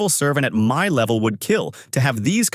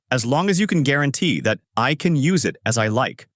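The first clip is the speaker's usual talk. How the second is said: ordinary delivery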